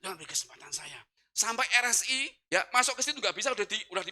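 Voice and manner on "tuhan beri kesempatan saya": very soft, lower murmured plea; prayer in the heart. Retold or imitated. Driver silently begs God for a chance, hushed. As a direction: pray under your breath. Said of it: "hanya doa dalam hati".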